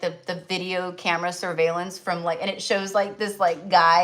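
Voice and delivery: ordinary delivery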